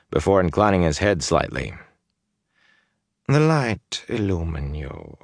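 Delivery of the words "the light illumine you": slow, smooth courtly greeting; a slow, silken noble's formal greeting; polite nobleman. Acted. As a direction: greet him with measured lordly politeness. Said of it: "he said politely".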